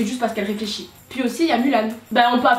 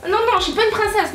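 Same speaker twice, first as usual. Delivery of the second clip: mock fury; 8 semitones higher than usual.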